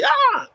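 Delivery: loud high "ah"; furious exclamation. Acted. Critic rushing up to the performer, hammed-up fervour. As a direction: cry out with mock fury. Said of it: "with the same furiousness".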